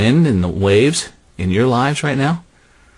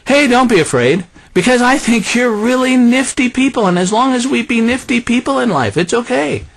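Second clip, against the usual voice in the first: high, breezy nifty-people voice; mock hippie Jesus; 9 semitones higher than usual. Preacher spoofing a groovy Jesus in the boat, high. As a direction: lay on the groovy vibe. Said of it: "And he didn't say".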